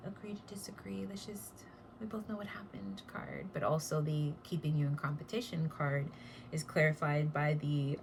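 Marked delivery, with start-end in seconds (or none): 0.1-3.0 breathy secretive whisper. Pretend. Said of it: "clarifying this Five of Wands reversed".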